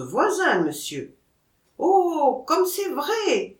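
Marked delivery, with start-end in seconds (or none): 1.8-3.6 high, drawn-out cry of agreement; crowd exclamation. A narrator's reading. Onlookers cry out at the monkey's likeness to a neighbour, exclaiming. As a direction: exclaim it high, stretching the words. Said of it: "s'écria tout le monde".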